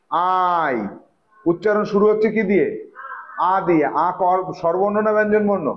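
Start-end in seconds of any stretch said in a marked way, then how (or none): 0.1-0.8 আই stretched slowly for spelling; drawn-out sounding out. Shown as a demonstration. Teacher writing the word in Bengali for pupils, drawn out. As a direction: draw out the vowel at the start of আই. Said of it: "বাংলায় লিখি তাহলে".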